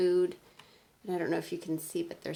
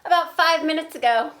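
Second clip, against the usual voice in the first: loud, higher casual answer; Piggy matter-of-fact; 6 semitones higher, 13 dB louder than usual. Acted. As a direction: toss it off as no big deal. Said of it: "when I say the piggy parts".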